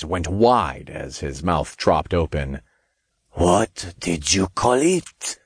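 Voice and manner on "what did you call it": put-on French accent. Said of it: "thick French accent".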